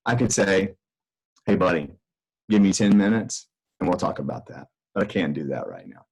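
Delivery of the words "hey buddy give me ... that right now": gentler, better language. Shown as a demonstration. Father to his son during a work call, gentle and patient. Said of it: "I could use my language a little better than that".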